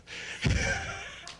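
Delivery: high breathy giggle, almost soundless; high airy laugh. Shown as a demonstration. Hypnotist shows subjects how to laugh, airy giggle. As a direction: let a squeaky laugh slip out. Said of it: "you already start to laugh".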